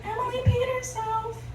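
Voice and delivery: high-pitched teasing voice; retold high, giggly taunt; mocking girls' taunt.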